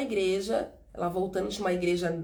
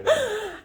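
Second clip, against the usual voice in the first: short laugh; 13 semitones higher, 4 dB louder than usual. Real, not acted. Laughs with husband.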